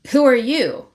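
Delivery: mock challenge. Rave to a stranger with fruit, challenging. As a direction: demand to know who they are.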